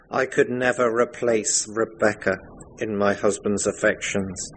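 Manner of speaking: ordinary talk, nothing marked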